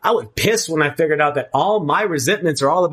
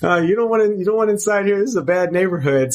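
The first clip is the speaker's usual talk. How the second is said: teasing, higher and quick, "not telling"; playful refusal; 5 semitones higher than usual. Retold or imitated. Telling curious friends to keep out, teasing playful refusal. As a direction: brush off nosy friends with a playful grin. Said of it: "And I'm like".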